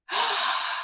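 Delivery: sharp intake of breath; delighted gasp. Real, not acted. Host reacting to a viewer's happy news, delighted gasp.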